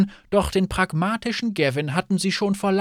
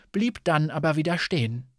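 plain reading voice

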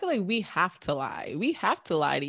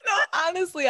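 laughing; 12 semitones higher, 5 dB louder, faster than usual. Real, not acted. Still laughing after outburst.

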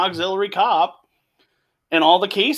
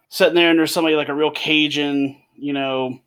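ordinary talk

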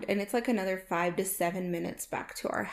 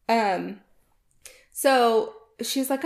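ordinary talk

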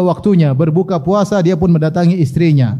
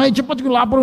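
higher-pitched mock hungry grumble; impatient anger; 6 semitones higher, faster than usual. Hungry husband hurrying his wife for food, mock-angry. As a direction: hurry her along in mock irritation. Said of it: "Ini tidak marah-marah".

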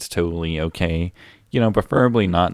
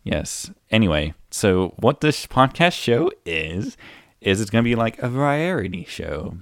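normal talking voice

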